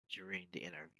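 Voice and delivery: ordinary delivery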